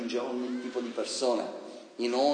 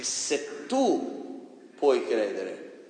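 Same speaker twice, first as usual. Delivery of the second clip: "se tu puoi credere" stretched very slowly, word by word; drawn out; much slower than usual. Shown as a demonstration. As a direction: draw out "se tu puoi credere" to drive it home. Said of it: "correcting the quote again". (molto lento e scandito)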